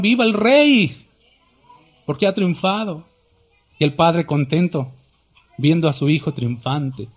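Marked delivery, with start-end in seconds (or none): 0.0-3.1 mock angels' cheer. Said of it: "gritaron y exclamaron".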